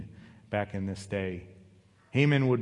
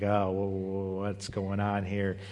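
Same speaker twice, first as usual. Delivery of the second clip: imitating a stunned stutter; mock stammer of disbelief; 4 dB quieter, slower than usual. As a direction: stumble on the first word, then drag it out slowly. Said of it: "he just started stuttering".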